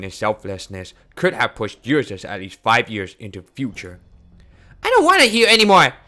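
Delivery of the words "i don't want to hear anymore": fast, loud, high outburst; frantic refusal. Acted. Shutting out talk of her father, a fast loud outburst. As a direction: rush it out to block his words.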